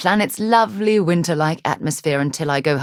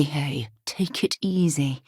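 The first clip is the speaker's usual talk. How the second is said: soothing whisper; 2 semitones lower, 5 dB quieter than usual. Acted. Calming someone down.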